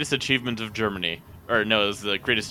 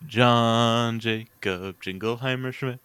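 slow deliberate; much slower than usual. Shown as a demonstration. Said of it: "Just give me a proper word".

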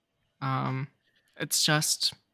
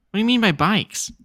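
higher, louder repeated question; 5 semitones higher, 6 dB louder than usual. Real, not acted. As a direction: ask it again, more insistent.

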